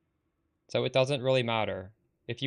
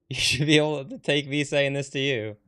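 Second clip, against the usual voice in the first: laughing through the words, louder; 3 semitones higher, 5 dB louder than usual. Real, not acted. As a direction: chuckle while you scold. Laughing while scolding chat.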